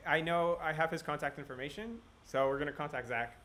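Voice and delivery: normal talking voice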